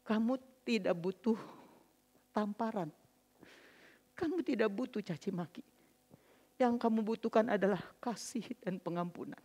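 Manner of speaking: retold husband's quiet reassurance; gentle forgiveness. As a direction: answer the remorse calmly and tenderly.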